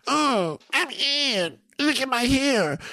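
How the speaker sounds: high, drawn-out mocking show-off; Ian. Showing listeners how his friend sounds, high vain mock voice. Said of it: "This is Ian right here".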